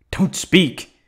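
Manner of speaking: furious silencing shout; sharp shout. Acted. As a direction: cut them off hard.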